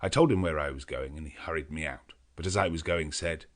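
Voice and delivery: ordinary delivery, nothing marked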